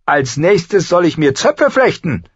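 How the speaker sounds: high, loud indignant outburst; furious, incredulous brother; sarcastic outrage. A narrator's reading. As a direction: throw it back in angry mockery.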